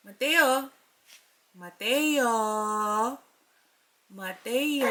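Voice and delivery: soft sing-song name calling. Real, not acted. Mother calling baby's name softly. (malambing na pagtawag sa baby)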